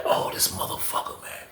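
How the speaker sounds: breathy hushed disgust; disgusted whisper. Retold or imitated. Seeing feces float in, whispering in disgust. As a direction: whisper it in disbelief. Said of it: "I'm like".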